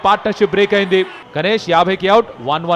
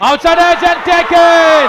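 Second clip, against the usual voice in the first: drawn-out loud call; excited wicket call; 9 semitones higher, 5 dB louder, much slower than usual. Real, not acted. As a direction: stretch it out and shout it. Wicket call in live commentary.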